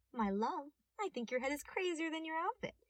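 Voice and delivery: teasing detective voice; sassy young detective. A narrator's reading. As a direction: throw his words back at him. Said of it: "he said, pointing the gun".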